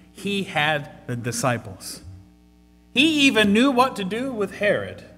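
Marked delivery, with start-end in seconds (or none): 2.9-5.0 loud, high, fervent preaching; preaching at full force. Real, not acted. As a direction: drive the point home to the congregation.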